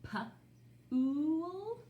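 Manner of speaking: P-ool split, rising and stretched. Shown as a demonstration. Teacher sounding out for children, stretched ooh. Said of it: "Let's try this one".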